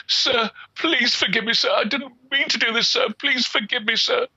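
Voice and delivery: put-on high tearful pleading girl; begging forgiveness. Weeping girl begging the peddler, high tearful pleading. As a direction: beg on your knees, near tears. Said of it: "begged and begged forgiveness".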